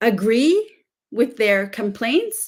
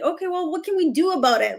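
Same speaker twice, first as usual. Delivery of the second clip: quick, higher, businesslike problem-solver; consultant mode; 7 semitones higher, much faster than usual. Retold or imitated. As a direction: rattle it off like a brisk consultant. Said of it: "I get into a consultant mode".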